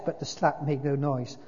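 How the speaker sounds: usual speaking voice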